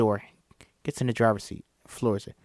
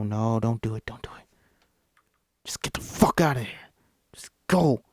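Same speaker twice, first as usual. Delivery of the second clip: tense whisper; 2 semitones lower than usual. Acted. Chris panicking alone in car.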